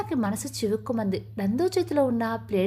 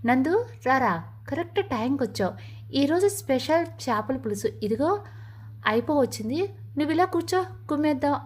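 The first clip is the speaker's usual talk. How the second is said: usual speaking voice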